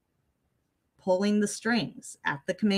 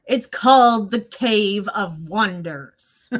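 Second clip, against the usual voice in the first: yelling at the screen; 7 dB louder, slower than usual. Retold or imitated. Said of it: "know what I yell at the screen".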